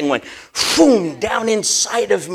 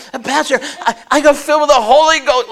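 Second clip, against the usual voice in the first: higher, louder, fast breathless report; excited report; 7 semitones higher, 7 dB louder than usual. Retold or imitated. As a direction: tell the pastor in a rush.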